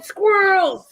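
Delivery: high, loud rallying cry, easing off at the end; high-pitched mimicked pep talk; imitated hype shout. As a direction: holler it like an excited promo. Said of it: "And M J said".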